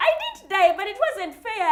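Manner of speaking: high, loud indignant protest. Real, not acted. To a friend asking whether she cried, an outraged high protest.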